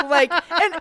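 laughing. Real, not acted.

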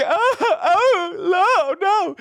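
high, loud fake wail; mock wailing. Wife putting on a show for the guards, hammed-up wail. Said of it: "She's screaming and wailing".

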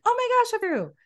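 a high, excited gasp of discovery; recalling a sudden breakthrough with a lift. Retold or imitated. Retelling her therapy breakthroughs, high and excited.

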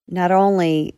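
ordinary delivery, nothing marked

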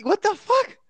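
normal talking voice

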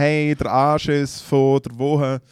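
slow, drawn-out segment title; slow show-host announcement. Acted.

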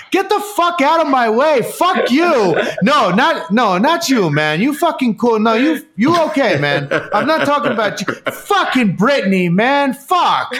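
high, hammed-up cursing, half laughing; mock angry rant. Boyfriend cursing at cameramen blocking him, hammed-up high rant. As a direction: swear at the crew, then backpedal.

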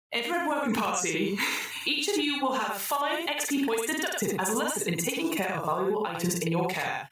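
high, bright AI announcer; the computer rewarding the troubleshooters, in a chirpy synthetic voice; cheery computer voice. Acted. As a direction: sing out the congratulations like a cheerful machine.

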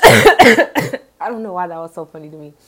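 coughing while laughing; coughing fit. Real, not acted. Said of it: "for all the coughing".

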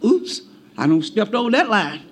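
sheepish backing down. Retold or imitated. Acted child realizing he overstepped.

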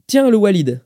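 called-out greeting. A narrator's reading. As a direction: hail someone coming through the door. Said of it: "lança-t-il en entendant la porte".

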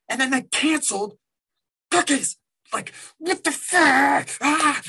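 a shrill real what-the-fuck of outrage; high exasperated squeal. Real, not acted. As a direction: squeak it out in disbelief. Outraged rant about cancelled cookies.

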